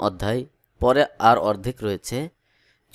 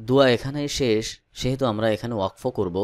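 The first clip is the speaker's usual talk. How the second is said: ordinary delivery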